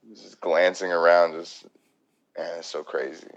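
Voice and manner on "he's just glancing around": ordinary delivery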